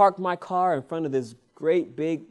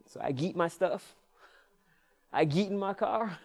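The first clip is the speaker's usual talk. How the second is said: normal talking voice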